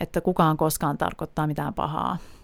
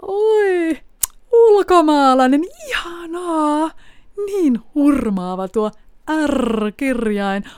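much higher, louder, slow gushing delight; charmed gushing; 9 semitones higher, 6 dB louder, much slower than usual. Retold or imitated. Charmed Dane gushing over the foreign accent, high and slow. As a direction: swoon over the foreigner, drawn out. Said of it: "Kolmas tyyppi on hurmaantuja".

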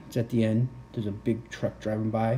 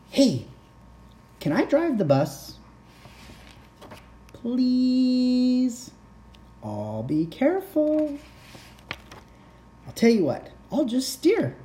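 high, eager pigeon voice; pleading pigeon; 14 semitones higher than usual. Acted. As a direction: beg like a cheeky pigeon. Pigeon begging.

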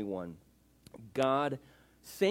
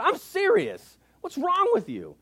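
high, loud, exasperated scolding; R C Sproul shouting; 9 dB louder, slower than usual. Retold or imitated. Old theologian to a laughing audience, exasperated shout. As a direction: insist on it over the crowd's laughter. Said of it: "As loud as he can".

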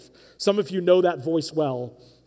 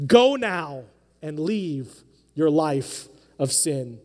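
slow solemn; 3 semitones lower, slower than usual. Retold or imitated. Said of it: "He says".

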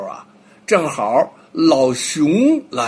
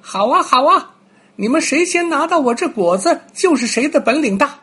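high, bright-eyed little squirrel voice, much higher; cheerful squirrel; 10 semitones higher, 4 dB louder than usual. Acted. Squirrel agrees to judge the race, high and eager. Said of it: "松鼠说".

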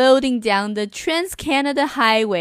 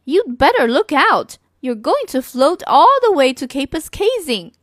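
high, loud playful clown warning; a showy circus clown calling up to the girl; louder, higher clown voice; 4 semitones higher, 5 dB louder than usual. Acted. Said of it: "The clown said".

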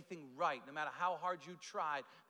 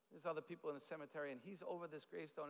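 ordinary talk, nothing marked

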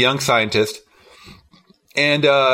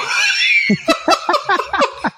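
high burst of laughter. Real, not acted. Laughing hard at the stupid movie scene, high squeal. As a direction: really crack up.

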